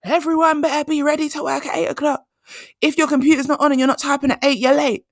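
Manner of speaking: high, loud mock yell of a bossy manager; boss screaming orders. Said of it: "She comes in screaming".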